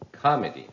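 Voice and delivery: "Comedy" with a loud opening COM, falling after; first-syllable stress. Shown as a demonstration. Teacher repeats the wrong committee, stressing COM in "Comedy". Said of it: "put the stress on the first syllable".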